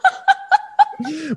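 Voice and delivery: squealing high laugh; real delighted laugh; high giggle. Real, not acted. Laughs at her own word.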